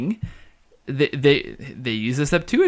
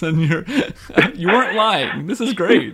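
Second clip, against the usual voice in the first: laughing through the words, a bit higher; laughing delight; 5 semitones higher than usual. Real, not acted. Co-host laughing at the crazy Bible facts, delighted. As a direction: giggle it out, really tickled.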